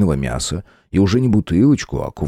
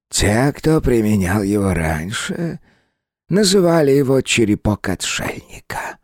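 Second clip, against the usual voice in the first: slow, high, eerie hungry spirit; 5 semitones higher than usual. Acted. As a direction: savor each word greedily. Well spirit explains.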